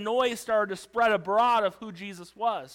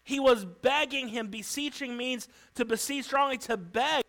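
ordinary delivery

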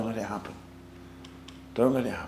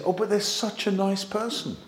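lightly voiced, higher-pitched protest; 6 semitones higher, 3 dB quieter than usual. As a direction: plead the case in a higher voice.

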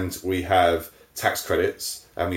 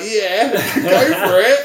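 laughing; 12 semitones higher, 6 dB louder, much slower than usual. Retold or imitated. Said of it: "And they were like".

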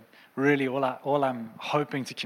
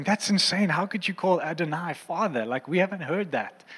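astonished disciples; 6 semitones higher than usual. Retold or imitated. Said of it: "And they were going".

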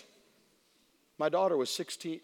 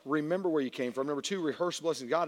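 ordinary delivery

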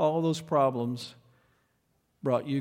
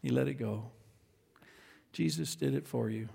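soft, gentle pastor voice; tender reassurance; 2 semitones lower, 7 dB quieter than usual. Retold or imitated. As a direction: comfort the child quietly. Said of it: "He said".

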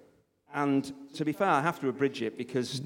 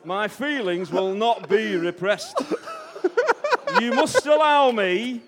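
loud, high, very slow Yorkshire declaration; hammed-up Yorkshireman booming each word out slowly; Mick McCarthy impression; 8 semitones higher, 8 dB louder, slower than usual. Mister Darcy declaring love to Elizabeth, comic loud Yorkshire voice. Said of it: "Mick McCarthy".

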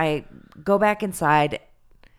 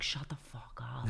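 hushed, breathy aside, nearly silent; whisper; 4 semitones lower, 14 dB quieter than usual. Retold or imitated. Whispering to Michael about the giggling girls, hushed. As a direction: lean in and whisper it low so the table can't hear.

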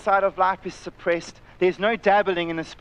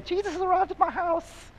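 mock excited post; 12 semitones higher than usual. Mock social media post.

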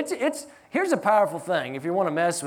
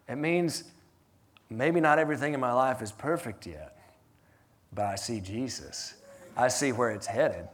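much lower and softer, drawing them in; intimate preaching; 7 semitones lower, 6 dB quieter than usual. Acted. Pastor speaking gently to the congregation, low and soft. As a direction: bring it down close and sincere.

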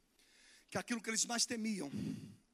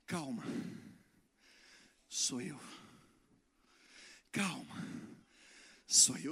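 lower, softer, calm voice of Jesus; calm reassurance; 8 semitones lower, 3 dB quieter, slower than usual. Acted. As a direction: soothe a frightened friend gently. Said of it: "Jesus não vai gritar". (calma, voz mais grave e suave)

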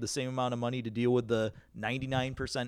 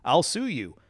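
higher, louder mock threat; mock customer threat; 5 semitones higher, 8 dB louder, slower than usual. Manager imitating an irate customer threatening to sue, mock loud. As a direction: toss out a customer's indignant threat.

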